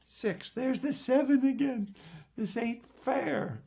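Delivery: high, whiny protest, slowing at the end; mock indignation. Magician finding the seven again, mock-indignant complaint. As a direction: protest like a thwarted kid.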